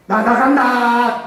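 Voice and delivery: slow, loud, high drawn-out "I'm leaving!"; spirit crying out. Retold or imitated. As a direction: stretch out the cry of a defeated spirit. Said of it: "나간다고 소리까지 하더라고요".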